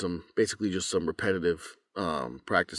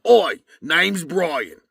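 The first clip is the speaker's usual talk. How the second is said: loud, high, drawn-out Cockney; exaggerated Cockney; 9 semitones higher, 10 dB louder, much slower than usual. Shown as a demonstration. Narrator showing an exaggerated trigger phrase, broad Cockney. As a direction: ham up the Cockney, loud and slow. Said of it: "like, exaggerated, I go".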